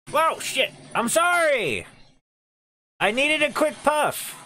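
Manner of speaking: high, loud startled yelling; panicked yelp. Real, not acted. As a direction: yelp in sudden fright. Jump scare, panicked apology.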